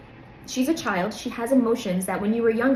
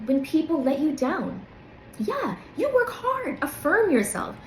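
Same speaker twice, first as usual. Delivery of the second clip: much higher, soothing and tender; lifted, reassuring voice to a hurt friend; loving self-talk; 7 semitones higher than usual. Shown as a demonstration. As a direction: talk to yourself like a best friend. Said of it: "Speak to yourself the way you'd speak to someone you love".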